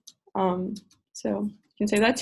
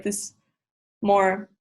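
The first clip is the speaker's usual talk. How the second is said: normal talking voice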